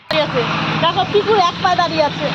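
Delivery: delighted showing-off. Real, not acted. Showing off child to viewers.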